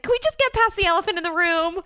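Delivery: high, loud, frantic confession; mock freak-out. Contestant confessing to Joe about the elephant in the room, mock frantic. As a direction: blurt it out in a hammed-up panic. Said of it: "She's freaking out".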